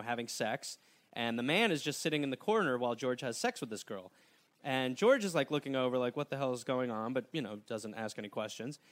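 ordinary talk, nothing marked

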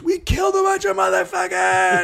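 hammed-up barbarian cheer; savage victory shout. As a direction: yell it like a bloodthirsty victor. Savage Thanksgiving celebrants voiced.

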